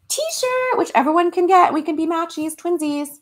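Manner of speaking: high, sing-out cutesy plug; high-pitched bubbly sales pitch; playful excitement. Real, not acted. Playful plug for her own shirt.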